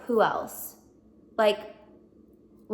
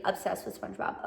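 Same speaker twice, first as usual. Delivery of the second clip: sheepish whisper; 3 semitones lower, 7 dB quieter than usual. Real, not acted. Sheepish admission, unvoiced.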